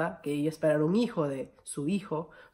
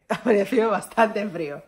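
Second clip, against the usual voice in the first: laughing exclamation; 5 semitones higher, 6 dB louder than usual. Real, not acted. Stressing how cold it was.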